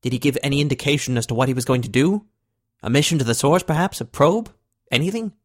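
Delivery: pleading, grasping for any hope; desperate. Acted. President pressing Eric about a mission, rising desperate questions. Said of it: "The desperation in the president's voice".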